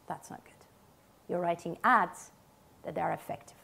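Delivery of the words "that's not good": whispered aside. Real, not acted. Aside dismissing award ads.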